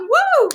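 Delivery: loud, very high "woo"; celebratory whoop. Real, not acted. Host cheering the winner's first prize, loud high whoop. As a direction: let out a big victory whoop.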